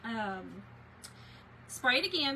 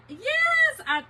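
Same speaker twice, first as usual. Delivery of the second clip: big high, loud yes; a sudden delighted yes, much higher and louder; excited yes; 12 dB louder than usual. Real, not acted. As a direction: burst out yes to a viewer. Answering a viewer comment live.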